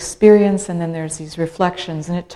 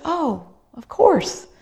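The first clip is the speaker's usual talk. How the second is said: slow understanding; 8 semitones higher, slower than usual. Retold or imitated. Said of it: "And I said".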